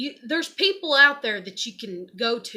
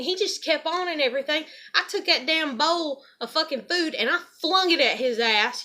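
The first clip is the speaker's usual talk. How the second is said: usual speaking voice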